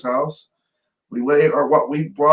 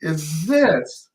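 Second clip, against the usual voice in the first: higher, drawn-out demand with heavy pauses; retold indignant grandmother; 5 semitones higher, slower than usual. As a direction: imitate a scolding grandmother, jabbing each word. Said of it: "this way of pointing that finger".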